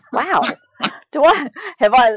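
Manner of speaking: high, wondering inner voice; incredulous thought. Retold or imitated. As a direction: wonder aloud in disbelief. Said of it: "I'm starting to think".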